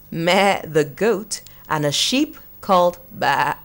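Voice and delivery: ordinary delivery, nothing marked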